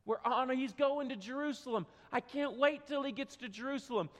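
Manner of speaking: ordinary delivery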